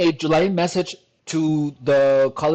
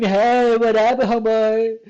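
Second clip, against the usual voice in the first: higher-pitched, drawn-out mock greeting; drag queen; 6 semitones higher, 4 dB louder, slower than usual. Drag queen greeting a homeboy, hammed-up. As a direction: play a drag queen hailing a friend. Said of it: "Imagine a drag queen".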